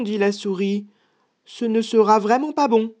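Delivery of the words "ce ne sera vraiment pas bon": high-pitched mouse objection; protesting mouse. Acted. Mouse talking the gruffalo out of eating her, high protest. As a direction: insist in a high voice.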